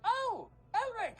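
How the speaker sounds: high, drawn-out calls of names; theatrical, high-pitched hello; effusive greeting. Acted. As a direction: sing out each name in welcome. Character greeting arrivals by name.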